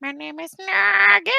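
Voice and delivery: silly creature voice. Pretend. Character voices being tried out.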